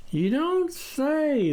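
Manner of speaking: drawn out, doubtful; repeated musing. A narrator's reading. The farmer repeating his favourite phrase, slow. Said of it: "the farmer repeated".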